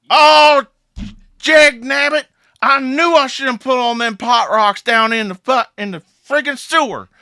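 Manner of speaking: loud, high, hammed-up Southern outburst; southern accent. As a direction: cuss and complain in broad Southern. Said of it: "speak in a southern accent".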